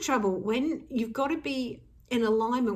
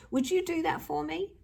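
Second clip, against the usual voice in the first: pleading ask; 6 semitones higher than usual. Acted. Acting pleading requests.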